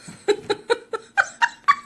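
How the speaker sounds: a shrill, helpless laugh; high burst of laughter. Real, not acted. Mom cracks up retelling her driving panic.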